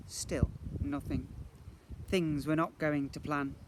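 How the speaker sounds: ordinary delivery, nothing marked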